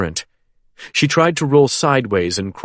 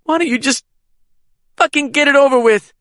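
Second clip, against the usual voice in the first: desperate defiance; 4 dB louder, faster than usual. A narrator's reading. Caitlin defies her attacker.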